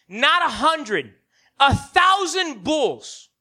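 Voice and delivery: loud, rising preacher's call; big sermon build; preaching at full force. Acted. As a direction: proclaim it to the congregation.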